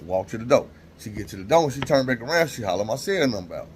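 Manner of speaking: ordinary talk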